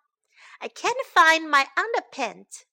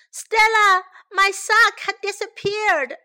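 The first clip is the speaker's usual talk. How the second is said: high, loud name call; 7 semitones higher, 6 dB louder than usual. A narrator's reading. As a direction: shout for someone in another room. Sam calls out again for help.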